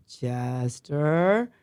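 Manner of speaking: CHESTER stretched long, low, rising at the end; drawn-out warning. Retold or imitated. Officer's last drawn-out warning.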